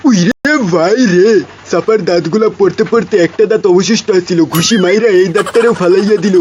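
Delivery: loud comic wail over a lost tooth; wailing in mock grief, loud; mock wailing lament. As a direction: howl it like a hammed-up mourner.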